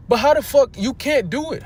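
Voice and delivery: indignant burst of disbelief; outraged outburst. Real, not acted. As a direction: blurt it out in outrage. Angry rant about erasing history.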